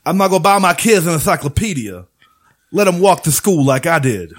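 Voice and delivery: lightly voiced Dusty Rhodes, sing-song; lightly hammed-up wrestler promo; Dusty Rhodes impression. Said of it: "I'm gonna do it as Dusty Rhodes".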